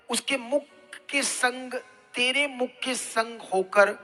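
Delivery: ordinary talk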